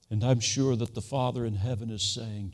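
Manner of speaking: ordinary talk, nothing marked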